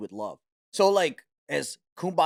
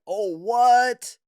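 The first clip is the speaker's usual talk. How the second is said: a loud, higher startled oh what; mock shock; 6 semitones higher, 6 dB louder than usual. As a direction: act out a scroller's shocked reaction. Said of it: "they're like".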